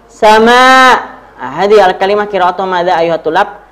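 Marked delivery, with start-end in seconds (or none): 0.1-0.6 high, loud model word, evenly repeated; drill call-out. Shown as a demonstration. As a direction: call it out and leave room to repeat.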